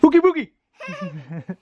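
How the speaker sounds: playful monster voice, slow at the end; startling boo. Acted. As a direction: creep up and spook the kid. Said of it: "Did I scare you?".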